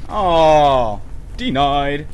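ordinary talk

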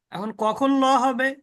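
ordinary talk, nothing marked

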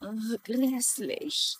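soft, slow shudder of disgust; a quiet, slow, disgusted groan. Acted. Wife hears about rattlesnakes.

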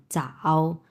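চাও held in a low third tone; third tone demo. Shown as a demonstration. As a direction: say চাও with a Mandarin third-tone dip. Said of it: "তিন number tone". (তৃতীয় টোনে চাও)

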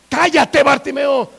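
sharp, loud rebuke; scolding shout. Acted. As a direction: shout him down like an annoyed crowd. Crowd hushes the shouting blind man.

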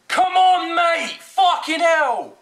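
high, very loud angry yell; exasperated shout. Real, not acted. Gamer yelling at slow opponent, exasperated shout. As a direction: yell at the opponent to get moving.

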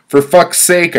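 high, loud outburst of frustration; exasperated shout. Retold or imitated. Fed up at the post office queue, shouting high and loud. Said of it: "I shouted".

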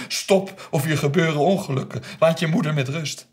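high, loud shouted order; alarmed command. Retold or imitated. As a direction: shout to stop the beating. Father intervenes as daughter hits mother. (luid, dringend geschreeuwd bevel)

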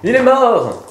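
triumphant cry of the game master; excited announcement. Acted. GM announces the kill. (annonce triomphale, criée)

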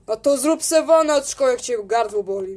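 usual speaking voice

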